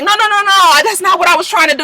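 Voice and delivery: high, fast, flustered denial; mock frantic protest. Voicing Jackie backpedaling to calm Tammy, high flustered protest. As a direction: squeal the no's like you're backpedaling.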